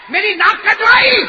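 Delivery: furious royal shout, high and loud; king's enraged shout; angry king. Acted. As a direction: bellow like a humiliated king. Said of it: "بادشاہ نے غصے ہو کر کہا".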